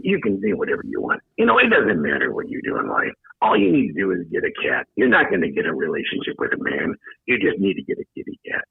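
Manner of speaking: low, soft psychic lady voice; a psychic reading in a low hush; Sylvia Browne voice. Acted. Sylvia Browne impression, named after.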